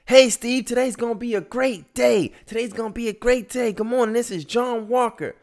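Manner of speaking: bright, very high and loud cheer; cheerful wake-up call. Retold or imitated. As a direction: sing out the greeting like a morning hype man.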